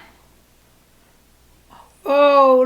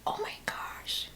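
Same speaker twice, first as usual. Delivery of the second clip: hushed, breathy whisper; 18 dB quieter than usual. Real, not acted. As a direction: whisper it in disbelief. Gross-out whisper during ear cleaning.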